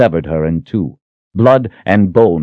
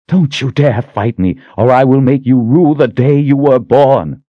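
angry threat; 6 semitones higher, 4 dB louder than usual. Acted. Sister threatens Richard.

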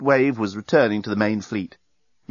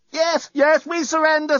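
much higher, panicked plea; screamed surrender. A narrator's reading. All three screaming their surrender to the fleet, much higher. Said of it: "screamed all three occupants".

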